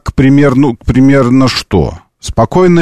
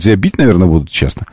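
ordinary talk, nothing marked

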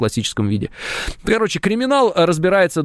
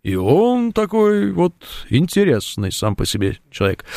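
slow, stretched-out impression of the minister; measured low voice; 2 semitones lower, slower than usual. Shown as a demonstration. Host describes the minister's way of speaking, low measured imitation. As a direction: draw it out low and deliberate. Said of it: "размеренно, у него такой низкий голос".

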